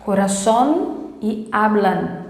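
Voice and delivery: "coração" as "corazón" and "hablan", slowly, each ending on a firm N; clear final N. Shown as a demonstration. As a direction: model both words with tongue-tip final N. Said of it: "cuidado com a letra N". ("coração e hablan" devagar, com N final marcado)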